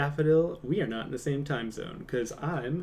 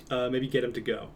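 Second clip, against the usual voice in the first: normal talking voice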